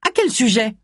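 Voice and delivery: high-pitched, curt question; curt suspicion. A narrator's reading. Sour housekeeper challenges visitors at the door, curt. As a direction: demand the reason like a grumpy doorkeeper.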